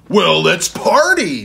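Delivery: slow, loud party call; booming. A narrator's reading. Ted invites the boy to party, booming. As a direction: boom it out like a giant friend. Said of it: "Ted boomed".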